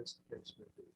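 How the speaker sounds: soft, under the breath; quiet. Real, not acted. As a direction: say it softly, almost to yourself. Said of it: "I'm sorry, what was the last part?".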